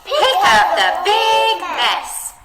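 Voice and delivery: big said high, loud and slow; dramatic read of big mess; expressive big. Shown as a demonstration. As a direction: stretch the word big out grandly. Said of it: "let's do it together".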